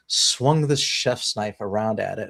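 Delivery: plain reading voice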